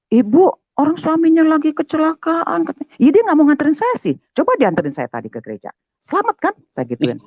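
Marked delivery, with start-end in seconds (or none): 0.1-2.7 high-pitched scolding protest; shocked reproach. Retold or imitated. Bystander rebuking wife of injured husband, high shocked reproach. 3.0-6.5 fast, higher-pitched comeback; indignant retort. Retold or imitated. Wife blaming husband before bystanders, fast indignant retort.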